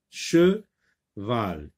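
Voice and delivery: "Cheval" as che, long pause, val; split into syllables. Shown as a demonstration. Teacher repeating the syllable count, "Cheval" split in two. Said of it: "Cheval comporte deux syllabes".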